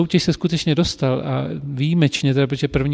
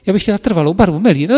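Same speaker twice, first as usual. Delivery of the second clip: louder, higher fast customer demand; mock shrill demand; 5 semitones higher, 5 dB louder, faster than usual. Teacher playing a demanding customer, mock screeching. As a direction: rattle off the order in a mock screech. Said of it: "a začala ječet".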